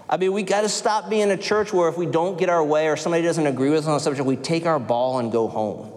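normal talking voice

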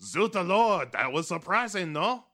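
high, loud mock French; French accent. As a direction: ham up a French chef.

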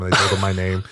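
ordinary talk

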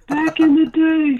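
put-on slow, high, creaky old grandpa. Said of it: "In my grandpa voice".

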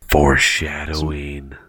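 gasp, then a slow, loud, dramatic word. Real, not acted. Friend mock-gasping at a story hint, slow and loud. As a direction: announce it like a movie trailer. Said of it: "you see what I did there".

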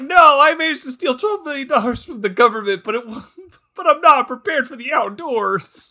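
high, fast put-on voice; mock whiny character. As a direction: play a pampered thief out of his depth. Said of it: "he's like".

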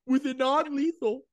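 mock guard.

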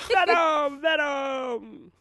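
high, drawn-out chanted shout; loud sustained cry, easing on the repeat. Acted. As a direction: belt out the word like a mock rap hook. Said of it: "That's my [name] impression".

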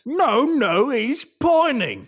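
high, loud, drawn-out shopkeeper protest; insistent protest. Acted. Shopkeeper insisting the parrot is pining, high protest. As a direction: plead it out high and slow.

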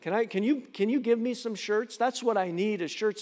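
ordinary talk, nothing marked

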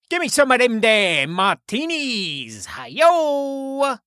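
high, slow, hammed-up British; badly faked British with drawn-out words; British accent. Showing off a British accent for the co-hosts, slow hammed-up British. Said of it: "let me try to do a British accent".

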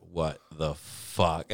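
very slow, low, dumbfounded; stunned dad. Retold or imitated. Dad watching the ATM swallow his card, slow and stunned. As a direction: drag it out like you can't believe it. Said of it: "My dad's just like".

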